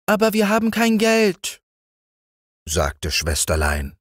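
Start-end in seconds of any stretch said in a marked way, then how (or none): 0.1-1.6 hohes Mädchenstimmchen, leicht singend; Kinderstimme. A narrator's reading. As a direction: wie ein kleines Mädchen aufsagen. (Liese stellt sich dem Zimmermann hoch vor)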